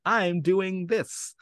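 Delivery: slow, drawn-out mock proclamation; mock announcement. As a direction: spell out each word like a big reveal. Declaring a project she lacks.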